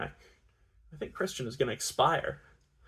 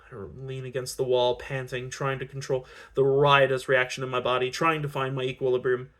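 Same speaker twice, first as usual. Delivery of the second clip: plain reading voice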